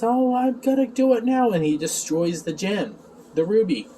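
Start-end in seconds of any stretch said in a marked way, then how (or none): 0.0-1.5 high-pitched mock threat; [name]. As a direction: play the villain about to strike, voice raised. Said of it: "gets close and says".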